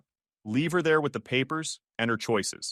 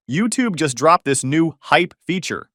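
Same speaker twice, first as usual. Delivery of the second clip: hype promo read; 5 semitones higher, 7 dB louder than usual. Acted. Narrator pitching listeners to smash the hype button, hyped and loud. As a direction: sell the hype button like an announcer.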